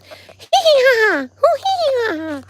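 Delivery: high, slow sing-song giggle of a game character; cartoon laugh. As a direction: mimic a silly cartoon dog's laugh. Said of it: "the blue dog guy that goes".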